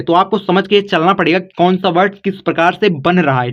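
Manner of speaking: ordinary talk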